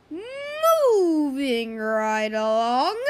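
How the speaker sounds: loud, hugely drawn-out mock announcement; exaggerated transition. Vlogger jumps to next topic, loud and drawn out.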